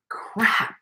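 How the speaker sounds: a dismayed whisper under the breath; hushed oh-no whisper; whispered curse. Retold or imitated. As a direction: breathe out crap with no voice. Discovers the camera missing.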